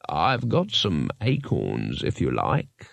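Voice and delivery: very low, slow tree voice; deep and slow, a kindly old tree; helpful talking tree. A narrator's reading. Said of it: "said the tree helpfully".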